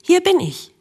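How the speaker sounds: high, bright happy call out; cheerful call. A narrator's reading. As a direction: call out gladly to a friend. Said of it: "rief Beweglich fröhlich".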